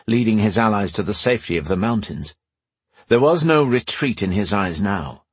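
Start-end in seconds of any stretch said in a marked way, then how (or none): none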